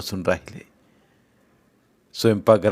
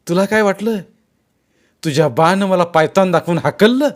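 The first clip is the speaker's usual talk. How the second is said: raised, heated threatening tirade; menacing snarl; 5 semitones higher, 6 dB louder than usual. A narrator's reading. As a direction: snarl threats at your captive, higher and louder. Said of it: "Dhanajirao गुरगुरला". (गुरगुरत, दरडावून धमकी)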